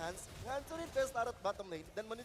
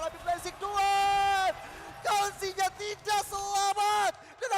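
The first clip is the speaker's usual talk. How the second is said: loud, high hype call; hype shout; 10 semitones higher, 10 dB louder, slower than usual. Real, not acted. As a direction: yell the kill call out. Caster hype call of a kill.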